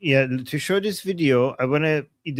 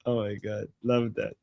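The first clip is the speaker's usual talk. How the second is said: ordinary talk, nothing marked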